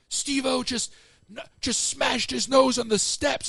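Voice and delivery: high-pitched, urgent mock report; imitating a stunned crew member; astonished radio report. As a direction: relay shocking news over the radio. Retold breaking news over radio.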